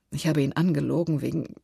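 plain reading voice

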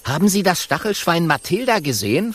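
lightly voiced young dragon; young dragon asking. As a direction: ask eagerly as the small dragon. Kokosnuss asks lion.

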